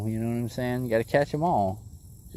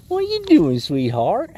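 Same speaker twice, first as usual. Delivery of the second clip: put-on baby talk; 4 semitones higher, 4 dB louder, slower than usual. Cooing to the fish.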